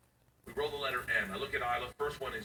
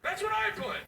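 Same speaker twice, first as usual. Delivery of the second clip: shocked outburst. Retold or imitated. Outraged at daughter's answer.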